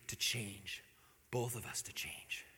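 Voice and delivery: soft, intimate whisper; preacher hushing the key point to his listeners. Real, not acted. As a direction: let the words sink in, hushed. Sermon point, dropped to whisper.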